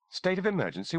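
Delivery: high, disbelieving repeat of State of emergency; incredulous echo. A narrator's reading. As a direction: throw the words back in disbelief. Wilson echoes in disbelief.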